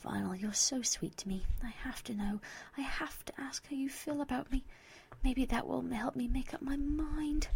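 soft, breathy near-whisper; hushed inner plea. Acted. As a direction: think it tenderly, working up courage to ask. Private, hesitant thought of confession.

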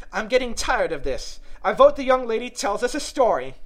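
high, loud character voice; bored, bossy March Hare. Acted. March Hare calling for a story instead, high and insistent.

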